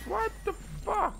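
high, slow, drawn-out outcry; slow incredulous wail; stunned disbelief. Real, not acted. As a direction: stretch it out, utterly baffled. Disbelief at the game.

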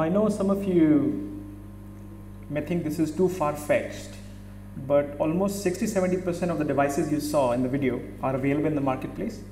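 ordinary delivery

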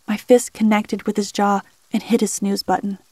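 usual speaking voice

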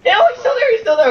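very high panicked yelling; frantic shriek. Real, not acted. Frantic gameplay moment.

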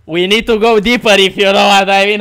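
loud, put-on English meme delivery; meme quote voice. Quoting the meme to stream chat, loud put-on voice. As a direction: ham up the movie line.

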